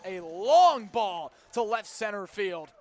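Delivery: ordinary talk, nothing marked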